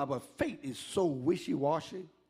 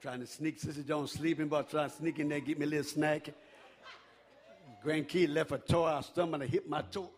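ordinary talk, nothing marked

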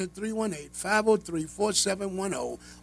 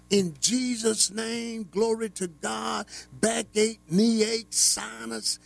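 raised-pitch, insistent preaching; fervent healing prayer; 4 semitones higher than usual. Acted. Preacher pleading the blood over listeners' ailments, fervent preaching. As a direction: call out each ailment high and forcefully.

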